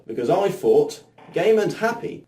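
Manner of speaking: ordinary delivery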